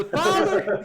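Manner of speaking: put-on high, loud girl's call. Daughter calls to father from inside, high shout. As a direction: holler for your father from indoors.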